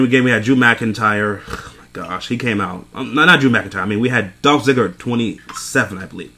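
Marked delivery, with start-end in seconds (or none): none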